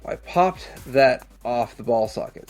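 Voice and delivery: ordinary talk, nothing marked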